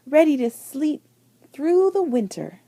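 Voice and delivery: usual speaking voice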